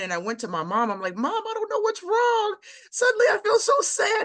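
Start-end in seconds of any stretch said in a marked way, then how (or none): 1.2-4.2 high, tearful voice, on the edge of crying; reliving the cry to her mother; tearful plea. Retold or imitated. Daughter crying to mom that she feels sad. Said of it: "and I just started crying".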